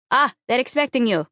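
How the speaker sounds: put-on light Eastern European accent, called out. A narrator's reading. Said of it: "he calls to me in an Eastern European accent".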